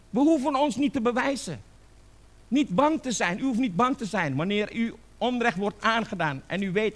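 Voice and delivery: high, emphatic sermon delivery; fervent preaching. Real, not acted. (vurige, dringende preek)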